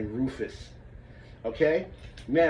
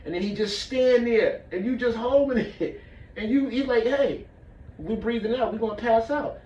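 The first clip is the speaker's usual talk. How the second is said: pitched way up, straining to hold the breath; strained high voice; 8 semitones higher than usual. Retold or imitated. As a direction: squeeze it out high like you can't breathe. Students straining, retold teacher scene.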